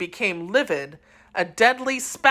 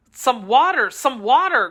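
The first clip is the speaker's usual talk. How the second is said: a raised, desperate call; urgent cry; 6 semitones higher, 3 dB louder than usual. A narrator's reading. D'Artagnan cries out for water for dying Constance. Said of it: "cried D'Artagnan".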